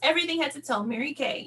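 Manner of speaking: ordinary talk, nothing marked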